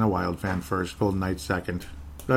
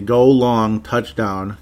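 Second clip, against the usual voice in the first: normal talking voice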